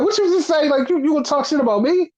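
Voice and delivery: high-pitched, affronted questioning; mock indignant challenge. Host voices Hangman confronting trash talk, indignant and high. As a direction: imitate someone squaring up over an insult, pitched high. Retold confrontation between two wrestlers.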